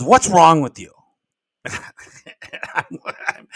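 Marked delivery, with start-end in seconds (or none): 0.0-0.9 loud, sharp challenge; retold mom's loud demand; confronting mom. Mom storms into son's office to confront him, loud mock scolding.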